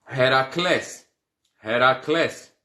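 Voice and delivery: Heracles with a breathed H, stress on the final syllable; careful Greek Heracles; pronunciation demo. Shown as a demonstration. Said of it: "é o Hércules".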